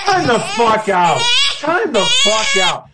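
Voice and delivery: loud, slow, laughing yell of protest; drawn-out laughing protest; time out yell. Real, not acted. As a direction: shout it out, half laughing, slowed down. Mock outrage at crying talk.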